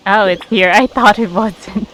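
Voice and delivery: normal talking voice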